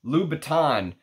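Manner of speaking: put-on Louboutin in a drawling Texas accent; mock Texan pronunciation of Louboutin. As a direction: say the name like a Texan. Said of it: "in my Texas accent".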